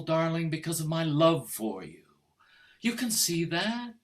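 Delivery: usual speaking voice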